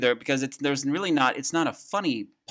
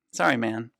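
ordinary talk

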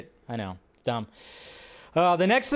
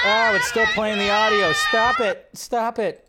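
loud startled shout at the audio; real outburst; 3 semitones higher, 4 dB louder than usual. Real, not acted. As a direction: yell to make the clip stop. Audio keeps playing unexpectedly.

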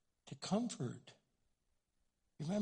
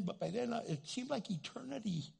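ordinary talk, nothing marked

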